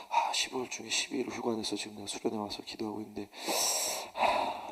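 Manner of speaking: soft, tired grumble ending in a sigh; weary complaint. Retold or imitated. Tired junior pastor complaining to his senior pastor, weary sigh. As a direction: sigh it out, worn down.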